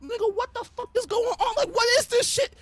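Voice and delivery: baffled villain. Retold or imitated. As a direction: play the stunned villain, high and lost. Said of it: "Madara th-, was like".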